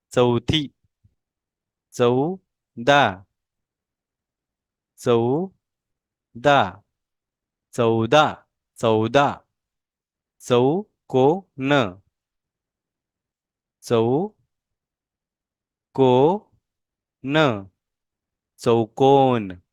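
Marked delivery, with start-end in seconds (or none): 1.9-6.8 चौदा drawn out slowly, falling; slow model pronunciation. Shown as a demonstration. Teacher models चौदा slowly for the class. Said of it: "मेरे pronunciation के ऊपर आपने ध्यान देना है". 10.4-17.7 चौकोन stretched out very slowly, falling; slow model pronunciation. Shown as a demonstration. Teacher models चौकोन very slowly for the class. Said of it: "मेरे प्रोनाउंसिएशन के ऊपर आपने ध्यान देना है".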